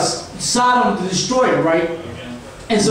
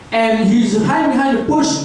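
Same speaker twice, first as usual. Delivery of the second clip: normal talking voice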